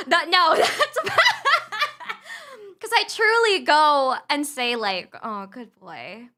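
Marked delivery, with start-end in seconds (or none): 5.2-6.3 low, slow, soft praise for a dog; doting dog praise. Retold or imitated. Owner to her dog, soft slow doting praise. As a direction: coo it at your dog, slowly. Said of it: "I genuinely say that to, like, my, my dogs".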